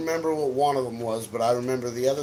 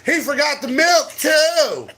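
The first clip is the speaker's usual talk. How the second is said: high loud tattletale shout; mock tattling shout; 11 semitones higher, 5 dB louder than usual. Friend ratting him out to his wife, high tattling shout. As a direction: shout it like a gleeful snitch. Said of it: "this bitch goes".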